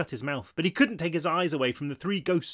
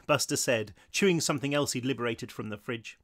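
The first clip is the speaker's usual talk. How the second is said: usual speaking voice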